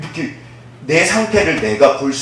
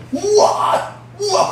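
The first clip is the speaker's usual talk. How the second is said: high, gasping cry of wonder; amazed wow; much slower than usual. Retold or imitated. People in the cave marvelling, high amazed wow. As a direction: gasp it out in awe. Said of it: "그러는 거예요".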